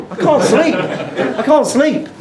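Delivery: high-pitched comic whimpering complaint; mock-haunted wail; hammed-up distress. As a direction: play still traumatised, pitched right up. Hammed-up lasting fear from the whisper.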